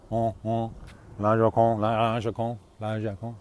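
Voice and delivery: nasal "La Joconde"; nasal French sound. Shown as a demonstration. Showing listeners how to fake French, nasal "La Joconde". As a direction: say "La Joconde" with nasal French vowels, "oh" through the nose. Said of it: "put a little nasal sound in it".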